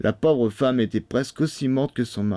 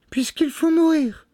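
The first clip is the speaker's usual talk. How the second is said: very high, pleading woman's voice; tearful wife; 3 dB louder than usual. A narrator's reading. Condemned wife answering her husband, high and pleading. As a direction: plead in a thin falsetto. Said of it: "des yeux baignés de larmes".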